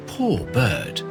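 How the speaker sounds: ordinary delivery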